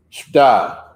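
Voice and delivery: "Shda" squashed into one loud, drawn syllable; shortened slang pronunciation. Shown as a demonstration. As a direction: blurt "Shda" loud, with the middle sounds dropped. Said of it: "We take all type of words out".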